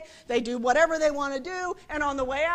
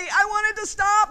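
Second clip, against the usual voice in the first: loud, higher outburst; desperate demand; 5 semitones higher, 7 dB louder than usual. Acted. The part of a parent feeling guilty, desperate demand. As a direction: cry out the fed-up part with force. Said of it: "part of you feels".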